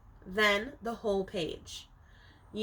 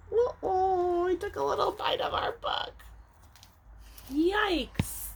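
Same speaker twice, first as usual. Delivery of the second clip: playful mock alarm; 8 semitones higher than usual. Playful twist at the end.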